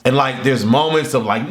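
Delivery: ordinary talk, nothing marked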